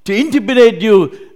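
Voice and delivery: loud, impassioned voice of God; loving proclamation. Acted. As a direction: proclaim it with full force. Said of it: "not the spirit or the tone".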